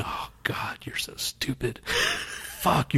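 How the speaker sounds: hushed, breathy-free whispered scorn; mock wife whispering insults; imagined wife whispering. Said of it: "his wife is probably going".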